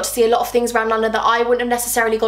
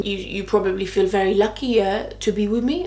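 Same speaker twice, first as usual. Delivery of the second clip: lightly put-on French accent; hint of a mock Frenchman's accent; bad French accent; 2 semitones lower than usual. Arrogant date presses her, bad French accent. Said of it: "doing a really bad French accent".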